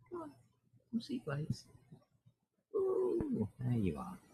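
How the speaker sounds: high, soft coaxing to the cat; coaxing a pet. Real, not acted. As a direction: softly lure the cat closer.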